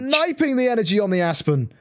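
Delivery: high-pitched live match call; excited play-by-play. Real, not acted. Caster calls an energy snipe, excited. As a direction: call the action with rising astonishment.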